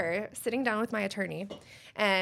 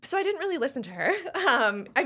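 laughing; 8 semitones higher, 8 dB louder, faster than usual. Real, not acted. Laughs telling the story.

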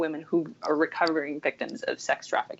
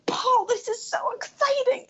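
much higher, bubbling with news for her husband; excited squeal. Retold or imitated. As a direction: burst in with the good news. Quoting herself telling husband news.

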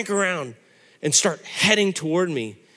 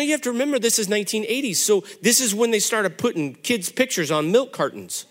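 usual speaking voice

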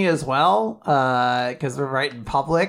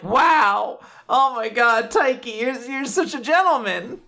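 gushing; 8 semitones higher than usual. Retold or imitated. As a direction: swoon over him, voice lifted high. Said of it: "And now I'm like".

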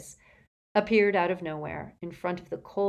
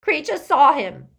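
high, loud tearful outburst; gasping elf; 9 semitones higher, 11 dB louder than usual. A narrator's reading. Elf answers Harry about the thief, gasping. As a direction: gasp it out through tears. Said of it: "gasped the elf as tears poured".

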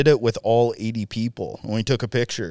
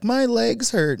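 put-on whiny kid; 11 semitones higher, slower than usual. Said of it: "He's like".

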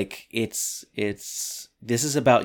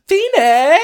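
high, loud call of "Feeney!"; a hammed-up shouted greeting; mock yell; 10 dB louder than usual. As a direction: yell his name as you burst in. [name] bursts in yelling.

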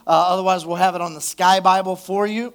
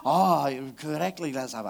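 put-on jokey Spanish accent, softer and lower; mock Spanish accent; 4 semitones lower, 6 dB quieter than usual. Pastor repeating the joke line in a mock Spanish accent. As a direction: end on a murmured Spanish "gracias". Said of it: "don't you want to say it with an accent".

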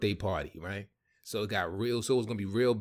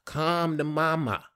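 slow, drawn-out, louder come to mama; hammed-up come to mama; 6 semitones higher, 3 dB louder, slower than usual. Shown as a demonstration. Manager demonstrating the greeting with more passion, slow and hammed. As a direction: show him how to really sell it. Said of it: "you gotta put more into it".

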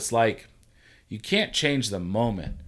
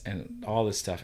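ordinary talk

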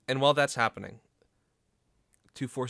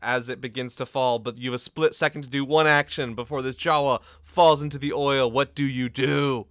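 loud, driving game master narration; urgent scene setting; 3 semitones higher, 8 dB louder than usual. Acted. Game master demands quick action.

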